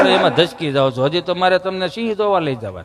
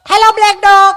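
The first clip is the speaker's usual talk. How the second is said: mock dog calling; 13 semitones higher, 9 dB louder than usual. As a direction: holler to a dog in an affected city voice. Visitor calls out to the dog.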